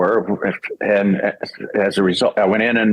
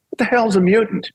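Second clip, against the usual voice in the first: high, incredulous retold question; baffled; 11 semitones higher than usual. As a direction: pitch it way up in comic bewilderment. Retold baffled reply in audition booth.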